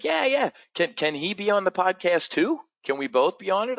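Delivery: high-pitched, excited imitation of a friend; bubbling, hopeful request; eager asking. Friend asking to bring a guest on the podcast, eager.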